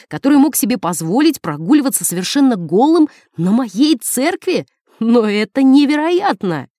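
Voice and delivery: scandalized, the pitch climbing; outraged. Acted. Priest, scandalized by news of the naked man, outraged.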